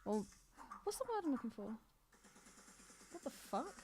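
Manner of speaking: usual speaking voice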